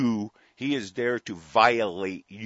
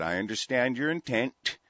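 ordinary delivery

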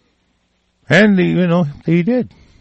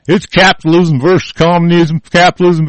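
higher, punchy parroted slogan; raised mocking chant-like delivery; mock sloganeering; 7 semitones higher, 4 dB louder than usual. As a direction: parrot it like a talking point. Mimics slogan people are told to repeat.

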